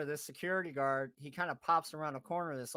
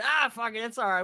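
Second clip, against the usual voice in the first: loud, higher, throwing caution away; retold breezy dismissal; 7 semitones higher, 10 dB louder than usual. Drinkers decide they can still drive, loud dismissal. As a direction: wave off the worry loudly.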